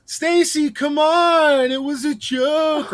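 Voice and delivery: high, pleading call after her; mock a boyfriend begging. Boyfriend calling after angry girlfriend, high pleading. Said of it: "her boyfriend, just like".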